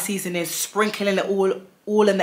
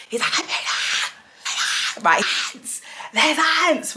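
retold husband shouting; 10 semitones higher than usual. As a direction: yell it like the husband bursting in. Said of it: "all I can hear is him shouting his mouth off".

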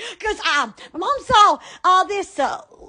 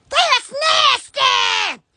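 disgusted; 7 semitones higher, much slower than usual. Real, not acted. Sees dirty air conditioner.

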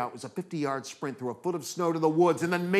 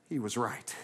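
plain reading voice

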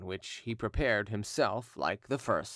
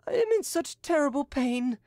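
cried out in pain; 3 dB louder than usual. A narrator's reading. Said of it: "cried Edouard".

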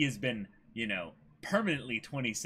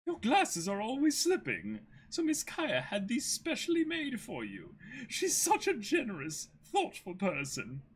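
much higher, gentle servant voice; polite butler; 7 semitones higher than usual. Acted. Merry to Kuro, explaining the glasses in a much higher voice. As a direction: present the gift warmly.

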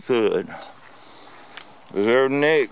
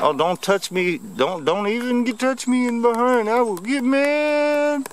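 voicing the turtle; 9 semitones higher than usual. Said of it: "It's like".

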